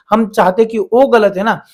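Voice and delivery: normal talking voice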